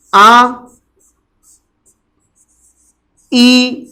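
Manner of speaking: "आ", "ई", "उ" each held long with pauses between; long vowels drawn out. Shown as a demonstration. Teacher sounding out the long vowels for students, drawn out. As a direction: stretch each long vowel slowly and clearly. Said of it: "बोलने का दृष्टिकोण और अंतर समझ में".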